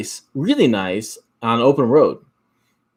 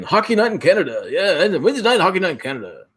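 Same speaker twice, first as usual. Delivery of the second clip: sportscast imitation; 5 semitones higher than usual. Imitates Canadian hockey broadcast intro.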